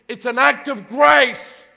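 loud, high and slow, each word landing; proclaiming grace. Acted. Pastor proclaiming grace to the congregation, loud and slow. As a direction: ring it out to the whole church. Preacher stresses grace loudly.

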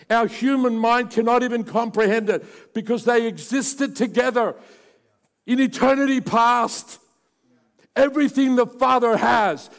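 loud, high, impassioned preacher; preaching at full force. Acted. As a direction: thunder it out to the congregation.

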